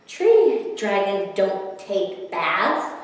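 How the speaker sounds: usual speaking voice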